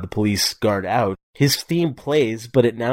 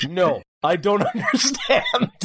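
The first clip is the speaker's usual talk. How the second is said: high, drawn-out protest breaking into laughter; hammed-up confusion, slowly stretched and high; mock bewildered henchman; 9 semitones higher, slower than usual. Co-host mimics the henchman answering his boss, mock bewildered. Acts out henchman's baffled reply in film.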